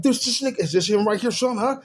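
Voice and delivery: high, loud and urgent; heated loud demand. Retold or imitated. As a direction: shout it out, pumped up. Dad confronting suspect with gun.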